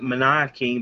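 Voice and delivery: Mania King said as "muh-NIGH-uh king"; pronounced like maniacal. Shown as a demonstration. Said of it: "like, maniacal".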